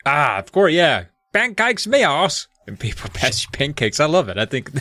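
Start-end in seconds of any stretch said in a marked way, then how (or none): 1.3-2.5 mock Cockney. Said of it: "Cockney rhyming slang".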